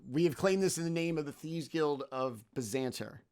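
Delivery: usual speaking voice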